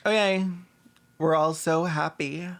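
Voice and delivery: ordinary talk, nothing marked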